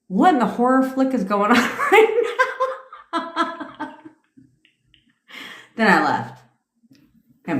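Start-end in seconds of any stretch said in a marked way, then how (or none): 0.1-4.0 high, laughing disbelief that fades out; losing it mid-quote. Retold or imitated. Retelling her horror-flick reaction, laughing.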